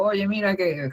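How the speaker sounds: put-on half-hearted Chilean accent opener; Chilean accent attempt. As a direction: start a quote in a Chilean accent, then break off. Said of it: "no me sale muy bien el acento chileno".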